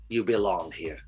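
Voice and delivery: usual speaking voice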